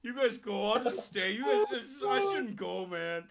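mock-pitiful drunk refusing to come along; drunk friend whining.

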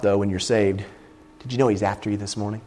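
ordinary delivery

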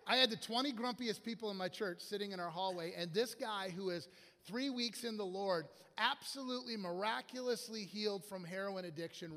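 normal talking voice

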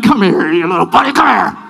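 low, rough grabbing voice; menacing unforgiveness. Acted. Unforgiveness luring its victim, low menacing voice. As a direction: act out sin snatching its victim. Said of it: "Unforgiveness is like".

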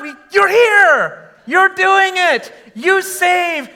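ordinary delivery

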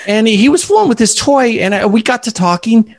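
put-on storytelling elderly man with a New York accent. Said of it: "this is my best New York accent".

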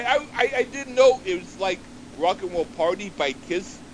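normal talking voice

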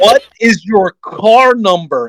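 slow, high, over-patient questioning. Retold or imitated. Boss slowing down for the rookie, high over-enunciated patience. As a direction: spell it out slowly like to a foreigner. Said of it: "Starts talking slower to you".

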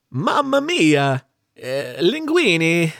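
slow, halting mock Italian accent. Host drops into character to prove he can, shaky attempt at Italian accent. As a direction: try an Italian accent, stretching the words out. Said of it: "I can do an Italian accent".

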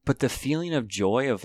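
normal talking voice

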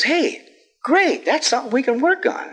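high, bright-eyed mock enthusiasm; cheery sponsor.

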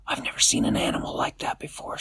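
hushed whisper; whispered in wonder. A narrator's reading. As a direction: breathe it in a whisper, awed. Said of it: "said Astro".

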